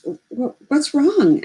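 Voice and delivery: frightened stammer rising high; stuttering. Acted. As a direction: stumble on the first sounds in fear. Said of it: "Emmett stutters".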